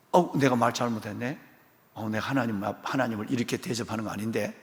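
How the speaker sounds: Abraham sheepish before God; sheepish regret. Acted. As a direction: quietly admit the mistake. Abraham repenting.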